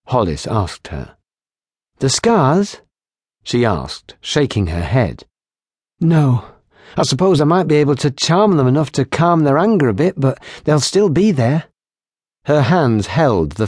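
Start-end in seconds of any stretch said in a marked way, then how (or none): 2.0-2.8 questioning barmaid. Acted. Kylie asks. 6.0-11.6 put-on higher, lively young barmaid voice; teasing barmaid. Barmaid teasing the lieutenant about her healing, higher and playful. Kylie explains.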